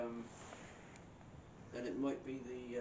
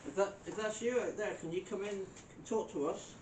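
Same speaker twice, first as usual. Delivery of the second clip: loud, higher call into the dark; 6 semitones higher, 3 dB louder than usual. Real, not acted. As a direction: call out to the spirits. Calling to spirits.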